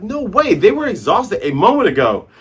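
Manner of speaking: high, loud exclamation of shock; acted disbelief. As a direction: exclaim it as a stunned onlooker. Acting out Nami's disbelief.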